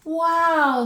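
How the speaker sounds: high, loud burst of wonder; a big amazed wow at a huge dragonfly. Acted. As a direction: gasp it out in awe.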